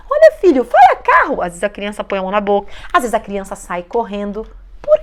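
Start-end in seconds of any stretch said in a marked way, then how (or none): 0.1-1.4 mãe pressionando o filho, voz alta e bem aguda; cobrança insistente encenada. Acted. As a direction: exija em tom agudo e forte. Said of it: "Quando você pega". (cobrança alta e aguda, encenada)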